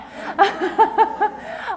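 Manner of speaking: loud amused laugh; hearty laugh. Real, not acted. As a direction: burst out laughing. Laughs at own joke.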